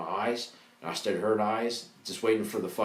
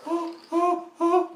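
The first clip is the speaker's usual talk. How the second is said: much higher, imitating a girl's panicked oh; 4 dB louder, much slower than usual. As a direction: squeak it out like the startled girl.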